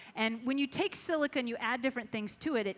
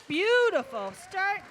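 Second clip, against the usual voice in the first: excited; 8 semitones higher, 7 dB louder, slower than usual. Real, not acted. Host praising live glass demo.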